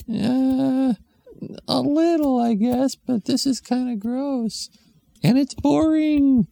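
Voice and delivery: put-on high, slow whining kid; child voice. Bored kid answering mom in the waiting room, high whine.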